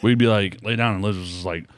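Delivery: ordinary delivery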